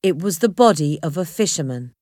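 plain reading voice

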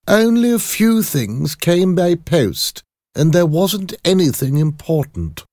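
high, loud put-on servant voice; servant character voice. Servant tells the lawyer about the post, high-voiced.

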